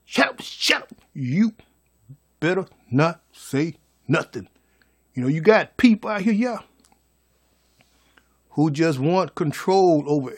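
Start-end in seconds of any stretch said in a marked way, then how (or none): 0.0-4.5 controlling hiss. Retold or imitated. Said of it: "they put that spell on you".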